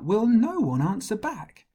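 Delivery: put-on high, loud young shout; David shouting out. The shepherd shouts at Goliath, high and loud. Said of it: "shouted out".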